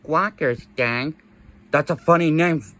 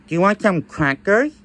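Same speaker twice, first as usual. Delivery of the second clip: ordinary talk, nothing marked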